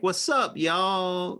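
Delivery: Southern flair. Shown as a demonstration. Teacher trying a greeting for teen students, Southern flair. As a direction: give it a little playful Southern twang. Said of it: "Southern flair".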